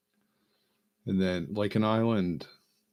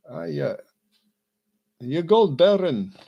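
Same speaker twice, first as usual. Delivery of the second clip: mock Jamaican accent; 9 semitones higher, 13 dB louder than usual. Jamaican pilot insulting a leprechaun, loud mock Jamaican accent. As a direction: stretch out gold in a bad Jamaican accent. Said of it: "I'm trying to be a Jamaican".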